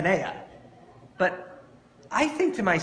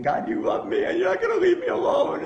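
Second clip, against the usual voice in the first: higher, louder anguished cry to God; mock sob-choked plea, higher and louder; desperate prayer; 4 dB louder than usual. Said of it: "held it believing".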